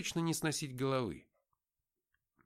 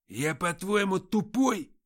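loud, high angry outburst from a thug; hot-tempered gang boss snapping; flaring up; 6 semitones higher, 8 dB louder, slower than usual. Acted. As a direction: blow up at him, offended. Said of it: "вспылил Антоха".

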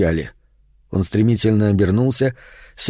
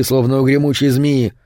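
usual speaking voice

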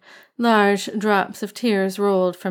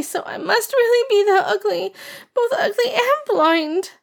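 put-on high tearful girlish whine; crying girl; 13 semitones higher than usual. A narrator's reading. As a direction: sulk and cry like a child. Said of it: "Ah Ching cried harder".

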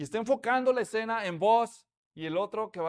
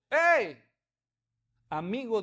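calling out; 4 dB louder, slower than usual. Acted. Boaz calls out to kinsman.

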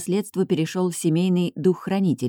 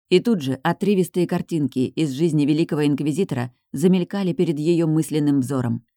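plain reading voice

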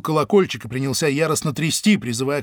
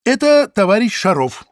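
quick interjection; 5 semitones higher, 6 dB louder than usual. Acted. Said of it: "быстро вставил Зайцев".

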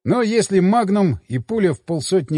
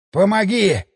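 hoarse, strained plea; wheezing plea; 3 semitones higher, slower than usual. A narrator's reading. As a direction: rasp out the call for help. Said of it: "просипел Семён".

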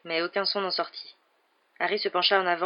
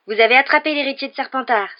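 high, loud eager shout; excited cry; 7 semitones higher, 9 dB louder than usual. A narrator's reading. A Ravenclaw girl shouting a guess, excited. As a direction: call it out hopefully. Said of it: "s'écria".

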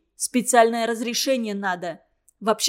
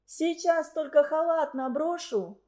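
higher, softer drowsy reply; sleepy voice; 5 semitones higher, 4 dB quieter than usual. A narrator's reading. Светлана calling from bed before opening, sleepy voice. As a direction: answer faintly, as if only just awake. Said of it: "послышался заспанный голос Светланы".

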